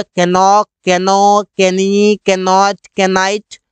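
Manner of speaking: के नॉक, के नो, के नी, के नॉट, के नाइट with the k sounded; wrong pronunciation. Shown as a demonstration. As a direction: sound each silent k aloud before the word. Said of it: "कहीं पर भी इस तरह नहीं बोला गया". (के उच्चारण के साथ गलत तरीका)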